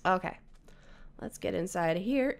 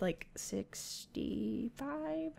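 drawn-out, very slow and quiet guess; hesitant, trailing thinking-aloud; unsure guess; 2 semitones higher, 11 dB quieter, much slower than usual. Real, not acted. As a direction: stretch it out while working out the number. Unsure guess at amount of sand.

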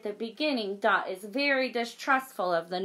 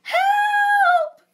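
very high, loud shriek; terrified bear; 12 dB louder than usual. Acted. The bear fleeing from Dot, shrieking. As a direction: scream for help. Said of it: "cried the bear".

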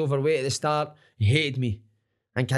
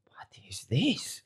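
slow, stunned whisper; whispered shock; 5 dB quieter, much slower than usual. Retold or imitated. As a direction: breathe it out in disbelief. Coach shocked by weight.

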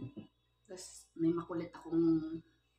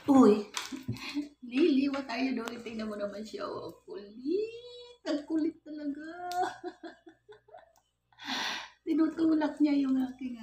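high, loud, giggly exclamations at the cat; excited laughing; 11 semitones higher, 7 dB louder than usual. Real, not acted. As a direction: squeal with delight at a naughty pet. Laughing at cat pushing phone.

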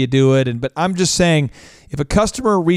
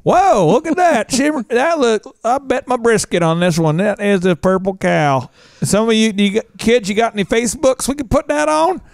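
a gawking country dad wanting to post it; 6 semitones higher than usual. Pretend. Dad voiced in skit.

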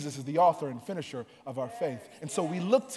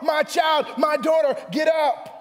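high-energy, raised sermon delivery; full-force preaching; 8 semitones higher, 7 dB louder than usual. Real, not acted. Preacher retelling Jairus pleading for his daughter, full-force preaching. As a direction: build to a loud, high cry of command.

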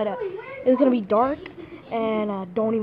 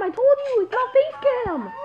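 insist, losing patience; exasperated; 11 semitones higher than usual. Real, not acted. Repeating himself, annoyed.

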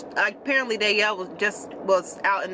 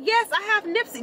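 much higher, polite stranger on the phone; caller's voice; 12 semitones higher than usual. Retold or imitated. Retelling the stranger who called about her dog, high polite voice. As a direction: lift way up into the caller's sweet phone voice.

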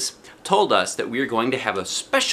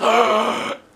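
excited gasp; 13 semitones higher, 4 dB louder than usual. A narrator's reading. Remembering another treat.

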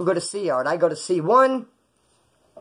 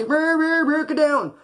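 rising, much higher barked order; mock cop yell; 12 semitones higher, slower than usual. Guard yells at fighting inmates to get down, mock yell. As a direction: play a guard ordering everyone down. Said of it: "Cop's gonna say".

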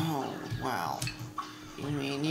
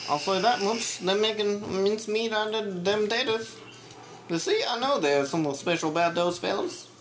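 high, fast, cartoonish gangster voice; cartoon character voice; 6 semitones higher than usual. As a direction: ham it up like an old cartoon henchman. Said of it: "That's a sick voice".

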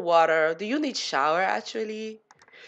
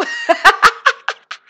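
squealing laugh, higher and louder; laughing at the chaos in her game; high excited laugh; 11 semitones higher, 8 dB louder than usual. Real, not acted. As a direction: burst into a giddy laugh. After mock panic.

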